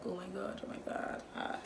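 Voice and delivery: soft, hushed pretend welling-up; softly acted teary reaction; mock tearful. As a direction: play being quietly overcome, half whispered. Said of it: "people will still have some tears to drop".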